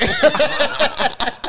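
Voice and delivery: big high burst of laughter; loud laugh. Real, not acted. Reacting to funny moment.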